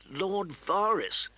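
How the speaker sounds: name him in slow amazement; slow, astonished recognition. A narrator's reading. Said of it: "Ned said in astonishment".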